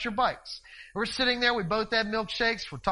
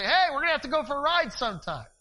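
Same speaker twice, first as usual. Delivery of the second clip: calling across the street; 7 semitones higher, 4 dB louder than usual. Retold or imitated. Neighbour yelling across street.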